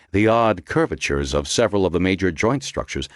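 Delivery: ordinary delivery, nothing marked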